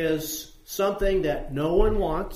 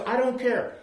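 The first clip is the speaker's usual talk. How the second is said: much higher, irritated brush-off; mock annoyed yell; 9 semitones higher than usual. Householder yelling down at the person knocking, acted irritation. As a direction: cut them off, high and annoyed. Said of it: "they yell down".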